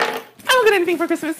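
tiny high voice, rushed; childlike squeaky voice. Pretend. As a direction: squeak it quickly like a kid. Childlike aside after Christmas remark.

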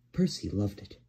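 ordinary delivery

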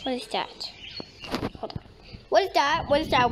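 ordinary delivery

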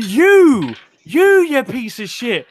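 high, loud, slow accusation; hammed-up outrage, leaning hard on each 'you'; mock accusing. Imitating the woman turning on her friend, mock accusing.

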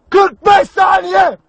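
very slow, stretched shout; bitter emphatic shout. Acted. Commander scorning brief TV fame, slow bitter shout. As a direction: hammer each word out slowly.

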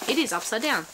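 normal talking voice

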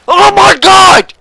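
high, loud, drawn-out yell; real high-pitched rage shout; enraged shout. Real, not acted. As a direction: scream in frustration at the game. Rages at the game.

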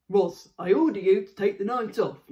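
gruff father voice. Acted. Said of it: "wrong voice again".

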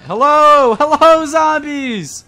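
high, drawn-out shouted greeting; loud calling out. Real, not acted. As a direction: holler slowly to the zombie horde. Calling out to zombies in game.

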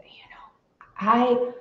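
choked near-whisper, tears coming; hushed words caught in tears; teary whisper. Real, not acted. Daughter recalling her dying mom, teary whisper. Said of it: "See, and I'm getting teary".

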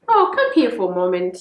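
loud call to someone nearby. Real, not acted. Mom calling Papa over for a moment, calling out. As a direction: break off and call them over loudly.

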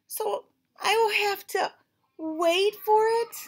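high, slow whimpering question; whiny dismay. Acted. Gerald asks Piggy if he must wait, high whining. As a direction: whine it like a let-down kid.

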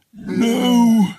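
high, loud ghostly refusal; corpse's loud no. Acted. Corpse refusing the parents' offer, loud high ghostly cry. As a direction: cry it out like the dead.

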